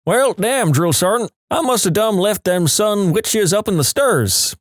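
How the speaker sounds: put-on a private in a thick Tennessee accent answering the drill sergeant. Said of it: "In this thick Tennessee accent".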